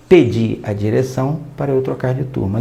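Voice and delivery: "Pedi" with a clear final i, stress on the last syllable; read as past tense. Shown as a demonstration. Teacher reading a student's note aloud, stressing "Pedi". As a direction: reads the note's "Pedi" as a finished request. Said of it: "E eu li".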